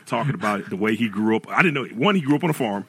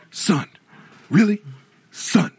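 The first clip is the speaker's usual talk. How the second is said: exasperated dad; 9 semitones higher, slower than usual. Retold or imitated. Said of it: "the first thing he says is what?".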